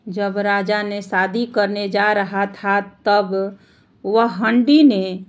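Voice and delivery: plain reading voice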